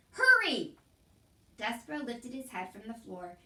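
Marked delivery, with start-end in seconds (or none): 0.1-0.8 high, loud shouted hurry; shouting. A narrator's reading. Cook shouts at Mig to hurry. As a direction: yell it sharply. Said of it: "shouted Cook".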